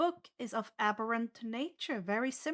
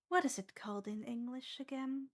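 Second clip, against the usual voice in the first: whispered aside; 8 dB quieter than usual. Real, not acted. GM whispers aside mid-narration.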